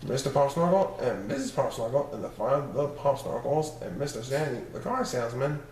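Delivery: ordinary delivery, nothing marked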